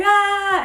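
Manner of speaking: high, loud imitated tantrum yell; frustrated toddler scream. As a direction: mimic a toddler's angry "ah". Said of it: "He gets really angry and frustrated".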